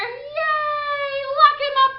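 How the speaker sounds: high, loud cheering yell; crowd cheering. Acted. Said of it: "they yell".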